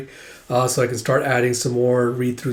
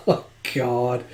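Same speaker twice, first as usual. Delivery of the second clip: normal talking voice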